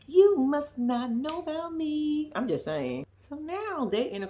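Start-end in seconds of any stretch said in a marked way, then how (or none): 0.1-2.3 very slow, each word spaced out; slow emphatic warning. Shown as a demonstration. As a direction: drag the warning out slowly.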